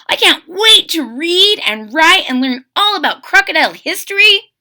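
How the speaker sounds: put-on high, loud eager child; excited young crocodile. William tells mama he can't wait for school, high excited child. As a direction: gush about school like a thrilled kid.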